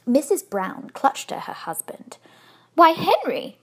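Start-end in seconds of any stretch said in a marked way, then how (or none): 2.8-3.6 high, loud burst of surprise; exclaiming. A narrator's reading. Wife to her husband on spotting the bear, exclaiming. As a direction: call it out in astonishment. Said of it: "she exclaimed".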